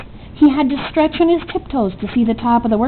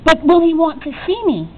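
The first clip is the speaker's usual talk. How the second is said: a higher call after her; cried out; 5 semitones higher than usual. A narrator's reading. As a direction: call out, pitch up. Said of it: "Punchinello cried out".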